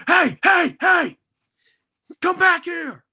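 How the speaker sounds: high, loud shout after someone; imitating an angry bathroom attendant shouting; yelling attendant. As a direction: bellow it like a furious custodian. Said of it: "being yelled at by".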